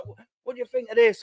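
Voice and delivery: high, lightly imitated Manchester accent; a quick Manchester accent for the stranger's question; a stranger in the stand asks in a Manchester accent. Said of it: "in a Manchester accent".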